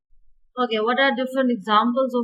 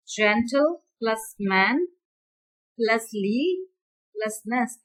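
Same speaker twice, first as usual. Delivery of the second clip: slow; much slower than usual. Shown as a demonstration. Teacher splitting word into morphemes.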